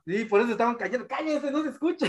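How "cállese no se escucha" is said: higher, softer imitation of an annoyed audience; hushed mock complaint with a laugh; shushing moviegoer. Imitating people silencing the cinema, mock shushing.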